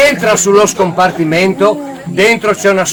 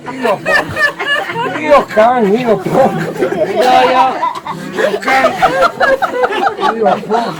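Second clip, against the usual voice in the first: cursing grumpy worker; muttered curses; 5 semitones higher, much slower than usual. Acted. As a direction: grumble curses while walking. Friulano cursing.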